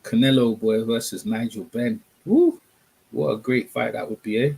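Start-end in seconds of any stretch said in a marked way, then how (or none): 2.3-2.6 short high whoop, loud; excited whoop. Real, not acted. Streamer to viewers, hyped about the dream fight, loud whoop. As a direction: let out a thrilled woo. Hyping imagined Canelo-Benn fight.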